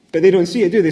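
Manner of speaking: ordinary talk, nothing marked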